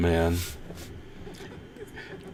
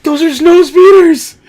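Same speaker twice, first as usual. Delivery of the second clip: real thrilled shout at the screen, much higher and louder; excited yelling; 10 dB louder, much slower than usual. Real, not acted. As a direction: yell it out, overjoyed at what you see. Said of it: "I'm yelling at the screen too".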